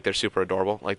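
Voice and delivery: usual speaking voice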